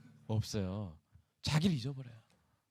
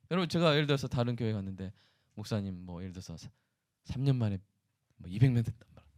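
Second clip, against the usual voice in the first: ordinary talk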